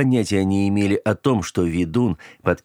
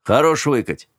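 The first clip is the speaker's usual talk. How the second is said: slightly raised voice; 6 semitones higher, 3 dB louder than usual. Acted. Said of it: "на полтона повысил голос Кент".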